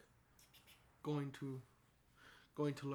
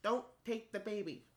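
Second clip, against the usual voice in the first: squeaky little kitten pleading, pitched up; high-pitched cat voice; 9 semitones higher, 6 dB louder than usual. Acted. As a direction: mew it like a tiny cat. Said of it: "in a very high-pitched cat voice".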